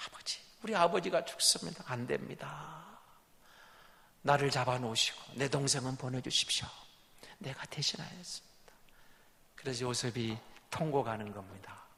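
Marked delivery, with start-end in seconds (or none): 0.1-8.7 quiet plea. Retold or imitated. Judah's plea retold quietly.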